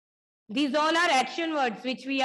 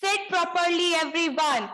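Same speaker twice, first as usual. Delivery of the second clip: stern command; 5 semitones higher, slower than usual. Real, not acted. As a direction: snap the class into order. Teacher reprimands restless class. (teacher scolding restless class, stern)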